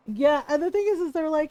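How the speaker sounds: ordinary delivery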